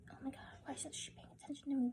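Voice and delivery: soft, hurried grumbling after a sigh; mock wounded complaint, under the breath; quiet sulk. [name] sighing, sulking at being ignored.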